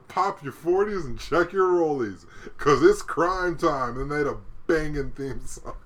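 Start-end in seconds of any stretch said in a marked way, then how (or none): 0.1-4.0 slow, loud, higher hype-man chant; slow, loud poser rapper impression; wannabe rapper voice. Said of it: "he said, uh".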